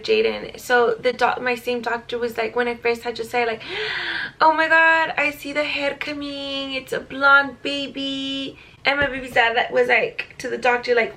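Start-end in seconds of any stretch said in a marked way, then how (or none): 3.7-4.3 quick surprised intake of breath; sharp gasp. Retold or imitated. Doctor gasping at the delivery, surprised. 4.4-8.6 slow, drawn-out amazed doctor voice; excited doctor. Retold or imitated. The doctor telling the parents she sees hair, amazed. Doctor exclaiming during delivery.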